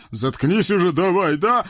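high, cartoon devil voice scolding his friend; a chubby devil needling his buddy; devil Khryak. Хряк обрывает. (высокий мультяшный чёрт)

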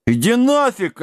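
high-pitched huffy retort; offended. A narrator's reading. Giant insulted about his name, offended. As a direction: brush him off, stung. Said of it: "обиделся Дибелмен".

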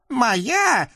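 very high squeaky devil voice, pleading; a scrawny devil begging off; whiny little devil. Acted. Наум удивлён. (писклявый чёрт)

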